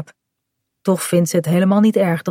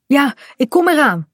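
lightly raised, higher-pitched call; 7 semitones higher than usual. A narrator's reading. Kaat answers as she heads for the boat, calling out. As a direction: call back that you're on your way. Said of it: "roept ze dan".

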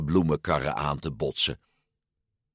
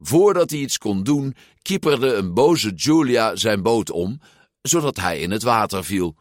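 ordinary delivery, nothing marked

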